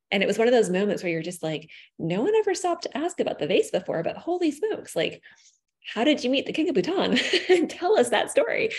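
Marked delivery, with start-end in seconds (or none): 2.0-8.7 high, thrilled voice, pitched way up; squealing with excited curiosity; excited disbelief. Retold or imitated. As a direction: gush with wide-eyed amazement. Excited retelling of asking mom.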